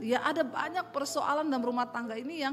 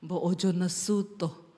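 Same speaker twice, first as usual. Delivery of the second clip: husband soothing; 6 semitones lower than usual. Retold or imitated. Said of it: "dia tuh lihat saya".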